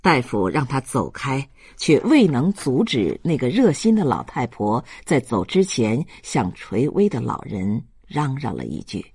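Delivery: ordinary delivery, nothing marked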